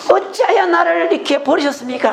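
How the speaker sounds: high, wailing mock plea to God; lamenting. Preacher voicing the psalmist's complaint to God, lamenting. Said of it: "막 탄식하기도 해요".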